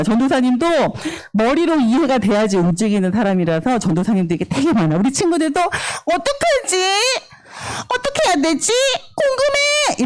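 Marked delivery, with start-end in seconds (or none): 5.6-10.0 put-on high-pitched childlike wondering; puzzled child. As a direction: play a kid full of questions.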